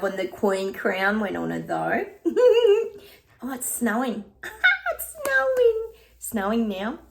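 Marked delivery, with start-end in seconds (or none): 2.1-3.0 high, loud laughter; high giggle. Real, not acted. Laughing about the queen crown going on, high giggle. 3.4-5.9 squealing excitement. Real, not acted. Seeing it start to snow, high excited squeal. Suddenly notices snow falling.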